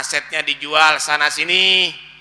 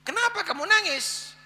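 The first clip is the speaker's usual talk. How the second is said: surprised; 4 dB quieter than usual. Acted. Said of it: "Kaget si suami".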